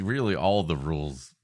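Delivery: normal talking voice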